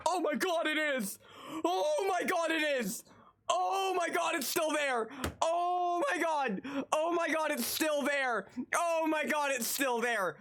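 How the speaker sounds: high-pitched, thrilled exclamations; excited disbelief. Real, not acted. Streamer finds the old toy listing still up, excited disbelief.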